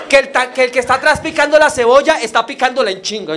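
normal talking voice

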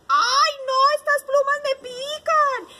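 high, loud, insistent wail; protesting sheep. A narrator's reading. Sheep demanding her wool back, loud protest. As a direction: cry out like an itchy storybook sheep. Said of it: "la oveja también dijo".

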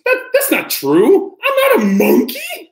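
very high, loud indignant squeal; mock protest. Reader squeals a pretend denial to the kids, high and loud.

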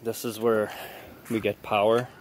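ordinary delivery

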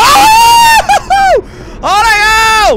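shrill high shriek of panic; panicked scream-laugh. Real, not acted. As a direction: let out a frantic scream. Hanako attack, scream.

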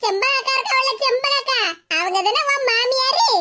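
normal talking voice

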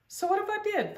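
high, cheeky retort; fairy grinning impishly. Acted. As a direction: tease with an impish grin. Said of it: "with an impish grin".

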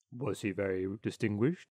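put-on low old rat voice, lecturing; old water rat. Water rat lecturing the duck on friendship, low self-satisfied voice. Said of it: "asked the water rat".

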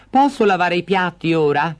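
put-on eager little boy offering help; child's voice. Hansel offers the old woman his help, boyish.

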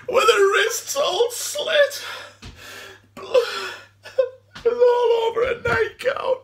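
high, loud sobbing breakdown; anguished crying. Acted. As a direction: wail it through tears, haunted by the sight. Breaks down recalling her body.

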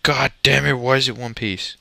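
frustrated outburst. Real, not acted. Only one coal dropped.

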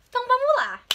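high, loud burst of energy; excited start. Real, not acted. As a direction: launch the quiz with a high shout. Starting the quiz, pumped. (animada, aguda e alta)

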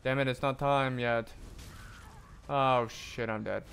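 ordinary delivery

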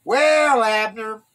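loud, high-pitched taunt. A narrator's reading. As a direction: jeer at him across a distance. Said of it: "David taunted".

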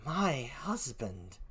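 put-on slow, near-silent British-accented echo; Emilia. Gentlewoman repeats the news, hushed in a British accent. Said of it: "please forgive my poor British accent".

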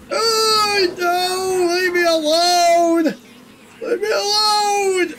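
high, slow crying plea; anguished denial. Real, not acted. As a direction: sob out the plea in terror. Crying as entity attacks.

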